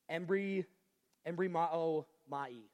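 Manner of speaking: "embrimao mai" spoken slowly, syllable by syllable; careful Greek pronunciation. Shown as a demonstration. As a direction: sound out "embrimao mai" with care. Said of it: "do my best to not butcher".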